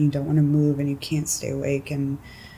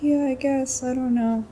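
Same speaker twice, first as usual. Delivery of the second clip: higher, small voice, mock meek shrug; reluctant agreement; 8 semitones higher than usual. Answering bosses who keep asking her to work, higher meek reluctant yes. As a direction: give in half-heartedly, no backbone.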